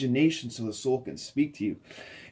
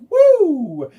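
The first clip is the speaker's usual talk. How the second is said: ecstatic woo; 13 dB louder than usual. Pretend. Ecstatic joy, soaring energy.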